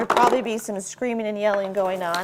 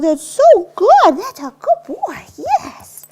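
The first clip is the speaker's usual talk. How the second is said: much higher and louder, slow gushing praise; over-the-top praise; 14 semitones higher, 11 dB louder, much slower than usual. Shown as a demonstration. Trainer rewarding the dog for looking back, gushing praise. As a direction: squeal with delight at the dog. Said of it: "make a big deal".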